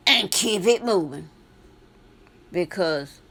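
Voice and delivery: normal talking voice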